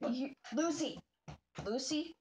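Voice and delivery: high-pitched, quick warning to a dog; scolding a pet. Real, not acted. Owner warns the dog about the kennel, high quick scolding.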